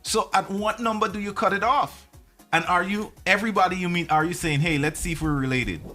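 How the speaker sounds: usual speaking voice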